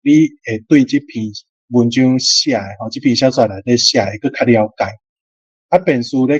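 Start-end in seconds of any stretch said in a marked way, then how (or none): none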